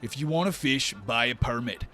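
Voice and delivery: loud officious order, easing down in pitch; gruffly dismissive guard. Acted. Guard orders them to buy a permit, dismissive loud voice. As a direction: open forcefully, then lay out the rules.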